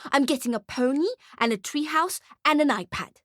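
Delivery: put-on high, bratty child voice; high-pitched smug brat; boasting little girl. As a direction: reel off presents like a spoilt kid. Spoilt girl lists her presents.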